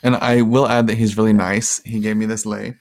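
ordinary delivery

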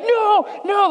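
high frantic no, no; cling on and plead like a scared child; mock-panicked child's cry; panicked kid. Dream kid resisting kidnapper.

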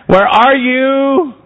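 high, slow call of God seeking. Acted. God calls slowly into the garden for the man. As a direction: draw it out as God searching the garden. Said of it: "Lord God called to the man".

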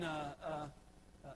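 ordinary talk

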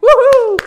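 loud, very high woohoo; celebratory whoop. Acted. Student cheering a cancelled test, high excited whoop.

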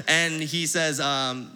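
ordinary talk, nothing marked

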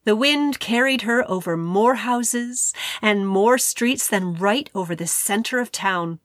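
ordinary delivery, nothing marked

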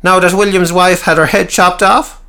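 high, sharp needling question; high voiced Cecil. A narrator's reading. Cecil needling Peter, high and sharp. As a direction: jab at him in a high voice. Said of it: "asked Cecil".

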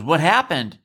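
normal talking voice

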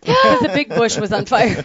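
said through laughter; laughing. Real, not acted. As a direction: laugh the punchline out. Laughing punchline.

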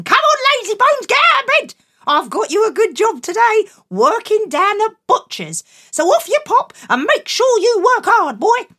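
high, loud mother calling; loud bossy mum. Acted. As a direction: wake him up, bossy and loud. Mum waking Jack loudly.

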